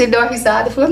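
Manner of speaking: normal talking voice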